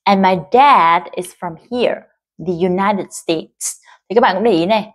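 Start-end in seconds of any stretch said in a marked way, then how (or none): 0.0-3.8 slow, leaning on "dad"; slow model reading. Shown as a demonstration. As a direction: read slowly, stress "dad". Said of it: "từ dad là một cái từ được nhấn". (đọc chậm, nhấn "dad")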